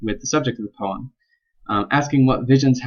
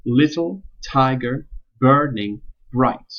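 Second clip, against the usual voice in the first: "little, tiger, burning, bright" beat out slowly, each first syllable stressed; metrical reading; 3 semitones higher, slower than usual. Shown as a demonstration. Student demonstrating the meter of line one, metrical reading. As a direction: stress LIT-tle TI-ger BURN-ing BRIGHT, with breaks between words. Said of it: "the meter in line one seems to say".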